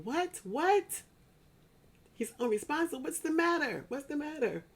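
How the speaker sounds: high, alarmed questions, pitch jumping up; shocked disbelief. Retold or imitated. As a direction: retell the panic of hearing the news on the phone. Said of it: "I'm like".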